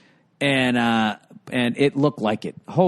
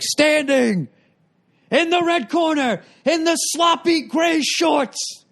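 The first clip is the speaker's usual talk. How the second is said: high, slow ring announcer boom; ring announcer impression; 12 semitones higher, slower than usual. Imitating the ring announcer, mocking a fighter's shorts. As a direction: belt it out like a boxing MC. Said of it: "he goes, this is this whole fucking thing".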